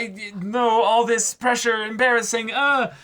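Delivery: high, strained, flustered squeak; flustered girl. Retold or imitated. Co-host voices Ryo, high-pitched and flustered under pressure. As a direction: panicky embarrassed little voice. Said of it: "the idea of".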